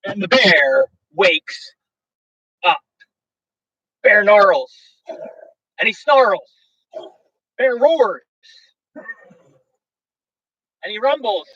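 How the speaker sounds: lively, sing-song read-aloud of a rhyming book; storybook reading. A narrator's reading. Host reading a bedtime story to viewers, sing-song.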